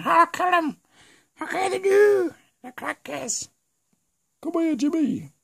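put-on very high, squeaky comic kid voice. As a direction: put on a piercing cartoonish squeal. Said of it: "these are the flippin' crankies".

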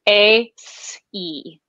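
ordinary delivery

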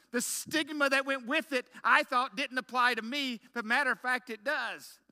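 high, loud, slow pulpit build; a preacher's full-voiced testimony; fiery preaching. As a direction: preach it out to the whole congregation. Preaching climax about hiking stick.

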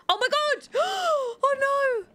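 high, gasping dismay; shocked gasp. Real, not acted. Player gasping as her Sim dog panics, shocked. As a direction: gasp at the ghost scaring the dog.

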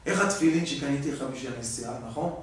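understated father asking his son softly; soft old father. Retold or imitated. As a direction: play the quiet gentle father. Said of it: "אבא אומר לו".

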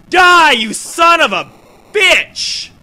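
slow, loud, high battle yell; triumphant curse. Real, not acted. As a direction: spit it at the boss as he falls. Final blow on boss.

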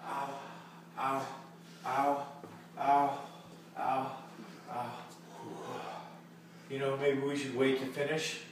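quiet pained moaning. Acted.

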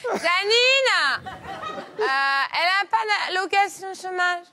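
high-pitched mock call across the office; calling a colleague. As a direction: imitate a clerk hollering to a colleague. Clerk calls out to colleague Janine.

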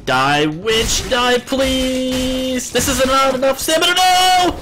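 strained plea rising to a high scream; desperate yelling. Real, not acted. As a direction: build from pleading to a shriek. Desperate last moments of fight.

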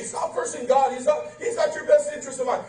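ordinary talk

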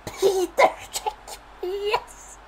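high, slow cry into a laugh; thrilled whoop. Real, not acted. Gamer whooping and laughing at the card he pulled. Icon revealed.

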